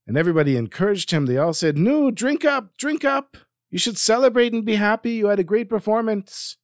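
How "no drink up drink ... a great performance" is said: much higher, eager voice urging him on; excited urging. Acted. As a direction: egg him on, high and excited. Party guests urge him to drink.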